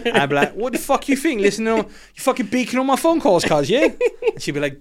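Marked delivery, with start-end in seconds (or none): 0.5-3.9 high-pitched mock-angry London roadman; Top Boy London slang. Caught on the phone by partner, indignant London roadman accent. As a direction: tell them off in a London street accent. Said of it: "it was Top Boy we were watching".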